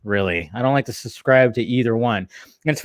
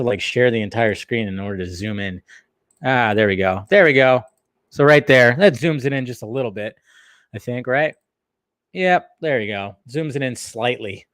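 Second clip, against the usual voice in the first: ordinary talk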